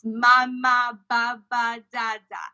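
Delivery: put-on Mama, baba, dada as baby babble; Mama said as two equal syllables; reduplicated syllables demo. Shown as a demonstration. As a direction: say each doubled syllable crisply. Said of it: "we call it reduplicated syllables".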